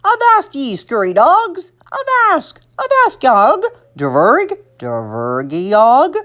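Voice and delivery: swooping pirate growl and squawk; bellowing pirate. A narrator's reading. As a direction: toy pirate barking sea talk. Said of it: "egg-shaped pirate that bellowed".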